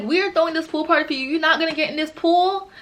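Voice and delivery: high, insistent mock questioning; pressuring friends. Friends urging her into their party pool, insistent.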